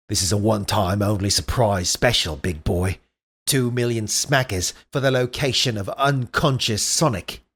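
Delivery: put-on Australian-accented weasel making a sales pitch; badly faked Australian, drifting toward Cockney. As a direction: pitch the deal in dodgy Australian. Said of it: "that's a shitty Australian accent".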